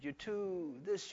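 ordinary talk

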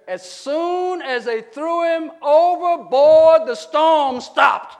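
slow, very loud, high proclamation; full-force preaching shout. Real, not acted. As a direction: thunder it out word by word.

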